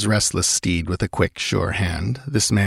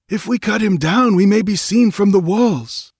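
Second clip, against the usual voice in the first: much higher, louder character voice; objecting nomad; 12 semitones higher, 4 dB louder than usual. A narrator's reading. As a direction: protest anxiously to the chief in a high voice. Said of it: "objected one of the nomads".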